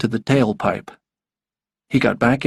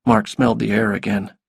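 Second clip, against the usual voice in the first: usual speaking voice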